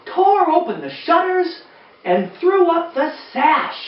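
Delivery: gentle read-aloud storytelling voice; lilting rhymed read-aloud; storybook reading. Acted. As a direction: read it like bedtime to a room of kids. Reading the exciting part aloud.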